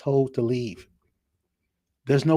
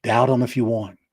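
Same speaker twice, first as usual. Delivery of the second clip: ordinary delivery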